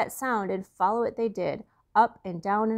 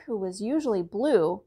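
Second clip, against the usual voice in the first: ordinary delivery, nothing marked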